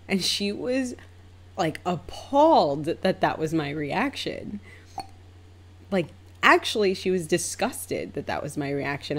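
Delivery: ordinary delivery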